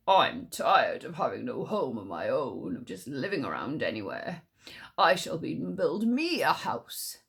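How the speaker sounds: deep tiger musing to himself. Acted. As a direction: voice the big tiger lazily grumbling. Tiger voice in story.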